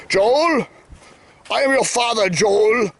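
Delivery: hammed-up, solemn villain's reveal; Darth Vader. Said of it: "He's the Darth Vader character".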